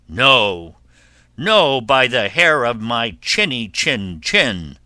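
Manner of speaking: loud, emphatic storybook refusal; firm refusal. Acted. Pig refuses to let wolf in, loud and firm. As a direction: refuse loudly and firmly.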